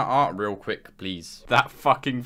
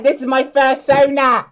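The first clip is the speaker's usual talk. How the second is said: proud furry voice; 10 semitones higher, 7 dB louder than usual. Pretend. Showing fursuit in call.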